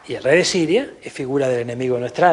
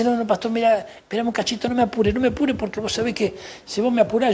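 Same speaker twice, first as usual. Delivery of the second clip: pleading excuse; 6 semitones higher than usual. Acted. Acts a member stalling the pastor.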